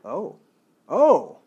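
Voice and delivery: rising oh, building to a loud cry; oh said three ways, growing to a loud exclamation; different intonations of oh. Shown as a demonstration. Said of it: "based on the intonation".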